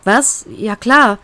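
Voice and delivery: usual speaking voice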